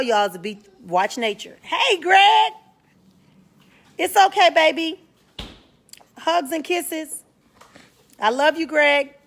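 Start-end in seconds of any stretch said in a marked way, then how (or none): 1.6-9.1 high, cooing voice for a friend; sweet high greeting. Real, not acted. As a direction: coo it up high like sweet-talking a loved one. Greeting a viewer in chat.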